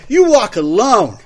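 put-on loud, high drunken sneer; belligerent drunk. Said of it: "That was my drunk Fred".

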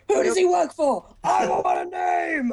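imitating a Batman-style interrogation. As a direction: ham up a gruff Batman demand. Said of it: "his voice went a little bit Batman".

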